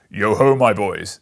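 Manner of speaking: loud, slow, hearty call; jolly Fezziwig shout. A narrator's reading. Fezziwig calls his boys to stop work, loud and hearty. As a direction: boom it like a merry old master. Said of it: "said Fezziwig".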